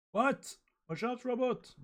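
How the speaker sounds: high-pitched cry of alarm. Acted. Manga character warns others of the robot, alarmed. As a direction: cry a sudden high warning.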